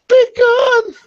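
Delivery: high excited yell; excited shout. Pretend. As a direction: yell it out with glee. Shouting after launching big guns.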